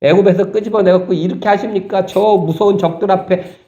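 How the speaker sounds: high, whining complaint to God; complaining Israelites. Retold or imitated. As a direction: wail like people sure they'll die. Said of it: "막 원망을 토했어요". (높고 징징대는 원망조)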